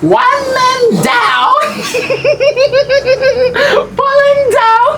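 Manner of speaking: slow, drawn-out singsong crowing with laughter; gloating triumph. Acted. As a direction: crow over a fallen victim. Gloats as drugged victim collapses. (mabagal na pagmamayabang na may tawa)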